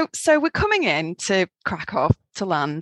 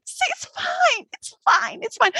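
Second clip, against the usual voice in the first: slow, higher soothing repeat; sing-song reassurance; slower than usual. Retold or imitated. To the scared man for the whole flight, slow soothing. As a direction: soothe him like a child, drawn out. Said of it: "entire time going".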